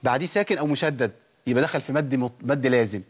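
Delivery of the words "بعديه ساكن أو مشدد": ordinary talk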